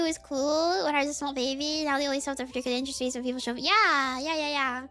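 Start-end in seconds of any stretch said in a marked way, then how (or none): none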